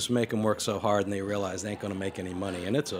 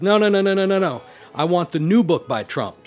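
much higher and louder protest; insistent shout; 8 semitones higher, 8 dB louder than usual. Retold or imitated. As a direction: cut him off, insisting loudly. Customer insists in a joke.

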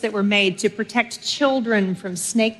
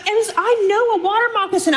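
indignant mother; 11 semitones higher than usual. Retold or imitated. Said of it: "So I, I'm like".

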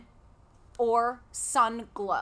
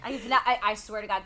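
ordinary delivery

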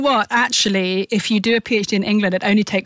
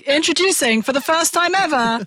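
raised-pitch, grand reception introduction; imitated wedding announcer; 8 semitones higher than usual. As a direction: imitate a brother announcing the newlyweds. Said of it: "my brother introduced us".

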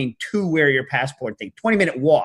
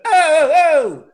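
high, loud, slow stretched "oh, oh, oh"; New York exclamation; 12 semitones higher, 8 dB louder, much slower than usual. Retold or imitated. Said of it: "that's New York".

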